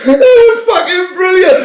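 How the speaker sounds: high, loud laughter shouting the words; laughing hard. Real, not acted. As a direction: roar with laughter. Laughing after punchline.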